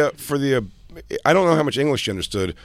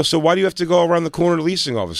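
fast, higher, incredulous retold question; exasperated question; 7 semitones higher, faster than usual. Jay retelling his question to the leasing woman, fast and exasperated. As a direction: fire it off at her in disbelief.

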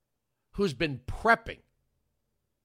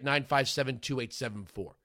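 usual speaking voice

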